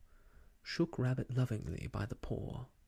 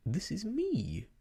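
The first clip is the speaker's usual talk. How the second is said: mild surprised protest; 7 semitones higher, 3 dB louder than usual. Acted. Pooh told he is away, mildly surprised. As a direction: Pooh objects, a touch taken aback. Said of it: "very much surprised".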